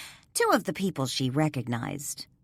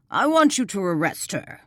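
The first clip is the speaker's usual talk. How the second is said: demanding; 4 semitones higher, 4 dB louder than usual. Acted. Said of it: "Stoddard demanded".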